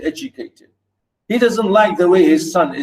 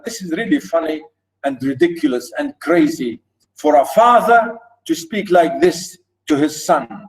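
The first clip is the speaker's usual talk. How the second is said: ordinary talk, nothing marked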